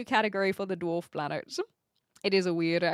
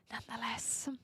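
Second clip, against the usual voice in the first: soft breathy whisper of nonetheless; 14 dB quieter than usual. Real, not acted. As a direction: drop to a whisper for the last word. Whispered aside after trailing off.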